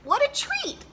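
very high, sing-song false delight; fake politeness. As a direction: gush politely over food you hate. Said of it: "said the frog".

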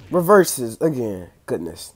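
ordinary talk

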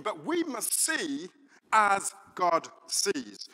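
slow, stressed as God sees; preacher driving home the point, slow; preaching emphasis. Acted. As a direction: lean hard on as God sees. Preaching climax.

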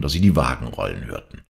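ordinary delivery

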